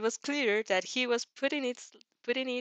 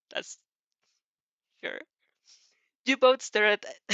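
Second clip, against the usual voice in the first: usual speaking voice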